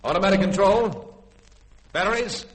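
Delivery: loud barked battle orders; shouted commands. Acted. Captain shouting fire orders at his gunners. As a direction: shout the orders over the guns.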